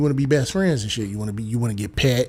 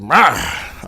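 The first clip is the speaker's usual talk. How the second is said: crow caw; 4 dB louder than usual. Retold or imitated.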